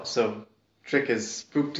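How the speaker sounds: usual speaking voice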